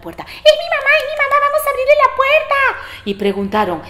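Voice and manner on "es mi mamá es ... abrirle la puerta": put-on high, squeaky kid voices; excited little goats. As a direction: squeal it like eager little kids. Kids excited at knock.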